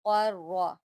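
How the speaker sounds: क़र्रा with the ra doubled. Shown as a demonstration. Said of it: "रा को हमने दो मरतबा पढ़ना है".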